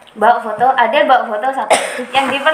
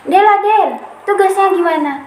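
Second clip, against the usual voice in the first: higher-pitched retold call to a classmate; 8 semitones higher than usual. Classmate asking Adel about homework, calling out. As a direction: imitate hailing someone with a question.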